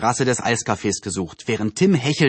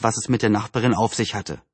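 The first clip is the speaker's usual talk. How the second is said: ordinary delivery, nothing marked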